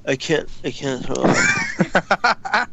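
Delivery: slow, strained heaving words; imitated retching. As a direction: groan it out as if gagging over a sink. Imitates friend retching over sink.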